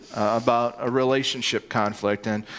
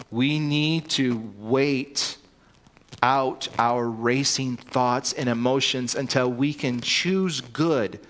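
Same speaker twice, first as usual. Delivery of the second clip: slow reading; slower than usual. Real, not acted. Reading quote about waiting slowly.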